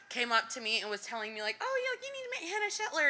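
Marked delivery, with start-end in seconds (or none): 1.6-3.1 high-pitched impression of an eager friend; imitated friendly urging. Acts out friend's eager introduction.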